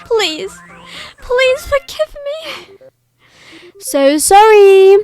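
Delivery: high, slow, begging please; desperate plea. Acted. Condemned boy begs the crowd for mercy, slow and high. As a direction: plead for your life.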